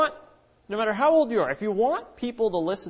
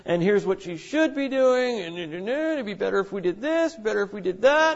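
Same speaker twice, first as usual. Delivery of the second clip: mocking complaints; 4 semitones higher than usual. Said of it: "she could just talk about how dumb they are".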